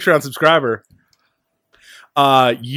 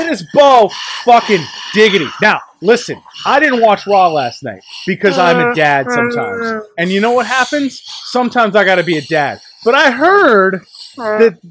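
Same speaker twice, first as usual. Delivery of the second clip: loud, fired-up voicemail rant; yelling rant; 4 semitones higher, 3 dB louder than usual. Acted. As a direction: yell it like an angry radio caller. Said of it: "I need to get my yell on".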